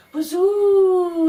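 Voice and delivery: very high, loud, drawn-out wind howl. Acted. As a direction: moan like a gale through the trees. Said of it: "the wind blew".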